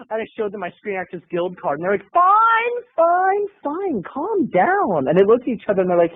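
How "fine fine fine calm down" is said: mock placating. Crew placating him.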